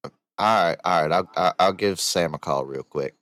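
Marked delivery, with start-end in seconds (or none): none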